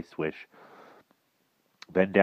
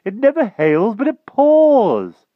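high, loud, stretched complaint; exclaiming; 13 dB louder, slower than usual. A narrator's reading. King remarks on his luck, exclaiming. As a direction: blare it out, dragging the last word. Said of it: "exclaimed the king".

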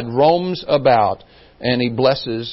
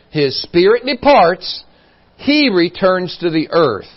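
scripture reading; 4 semitones higher, 5 dB louder than usual. Acted. Rereads the verse.

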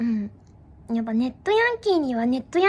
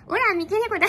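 higher, hammed-up tough-girl boast; playing a net thug for chat; mock yankee; 6 semitones higher, 4 dB louder than usual. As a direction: puff up like a cute fake delinquent. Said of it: "ネットヤンキーになります".

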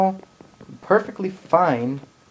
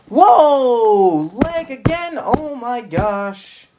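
loud, much higher shout of surprise; sudden surprised outcry; startled whoa; 7 semitones higher, 6 dB louder, much slower than usual. Real, not acted. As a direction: yelp as the lag hits. Lag hits suddenly.

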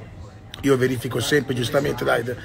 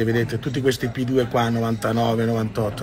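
normal talking voice

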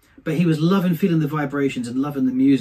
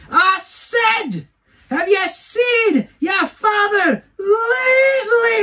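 even higher shriek, repeating insistently; screaming; 3 dB louder, much slower than usual. Retold or imitated. Old lady repeating herself to deaf lad, screaming higher. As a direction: scream it slowly at the very top of your voice. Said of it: "she screams at him".

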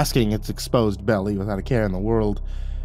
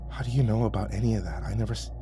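softer hushed question; whispered; 8 dB quieter than usual. Acted. Keeper asks the dragon under his breath, softer. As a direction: keep it down, shaken. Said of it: "let out a shaky breath and whispered".

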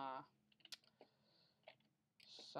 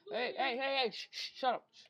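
much higher and louder hey, then hissed shh; loud shushing; 7 semitones higher, 7 dB louder than usual. Real, not acted. Gamer hushing his mother while recording, loud shushing. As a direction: cut off the noise with sharp calls and shushing.

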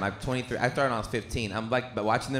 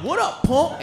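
tough guy challenge; 8 dB louder, slower than usual. Retold or imitated.

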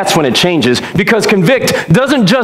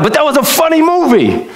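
high-pitched, whiny protest; mock mad; 8 semitones higher than usual. Preacher protesting that he cannot watch old movies, mock mad. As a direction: complain as if mildly outraged at losing a favourite. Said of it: "I was so mad".

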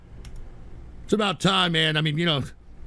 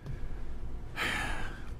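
heavy sigh; 15 dB quieter than usual. Real, not acted. Sighs at chat.

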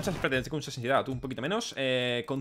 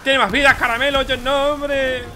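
raised voice, high and loud; loud protest; 8 semitones higher, 6 dB louder, slower than usual. Real, not acted. Streamer protesting to a viewer about lives, high loud complaint. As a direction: protest loudly at a high pitch.

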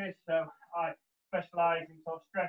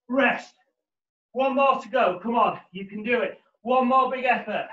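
gym bro; 5 semitones higher, 9 dB louder, slower than usual. Acted. Fitness coach calling workout cues.

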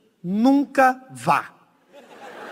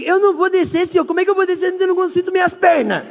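much higher, fast frightened pleading; panicked whine; 10 semitones higher, 4 dB louder, faster than usual. Retold or imitated. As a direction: plead in high-pitched panic. Tigas em pânico no escorregador. (choramingo agudo em pânico)

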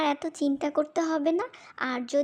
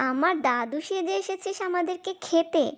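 ordinary talk

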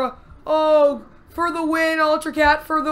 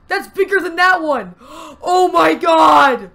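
loud, slow stunned shouts; shocked exclaiming; 6 dB louder, slower than usual. Real, not acted. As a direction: yell in disbelief at its size. Awe at huge creature.

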